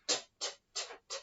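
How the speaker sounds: t, t, t as unvoiced puffs; whispered t sounds. Shown as a demonstration. Teacher whispering the t sound for phonics. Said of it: "what sounds can you hear".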